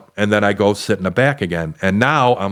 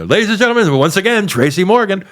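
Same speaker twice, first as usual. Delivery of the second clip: emcee announcement; 9 semitones higher than usual. Retold or imitated. Club host bringing the headliner back, high and booming.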